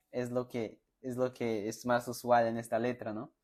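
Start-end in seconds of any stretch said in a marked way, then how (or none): none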